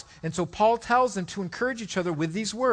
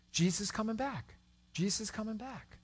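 soft refrain; 4 semitones higher, 8 dB quieter than usual. Acted. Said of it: "repeated refrain, softer".

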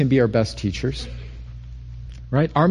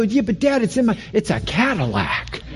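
put-on higher, eager kid pitching his dad; eager pleading; 7 semitones higher than usual. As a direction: sell dad on the car, lightly voiced. Young self pleading with dad.

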